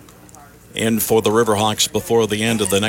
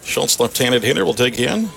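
radio baseball announcer. Acted. Live play-by-play call.

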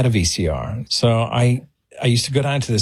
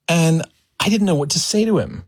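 ordinary talk

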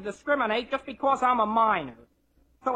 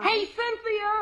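slow, high call across the room; 11 semitones higher, much slower than usual. Acted. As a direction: call her name from afar. Calling a girl across the room.

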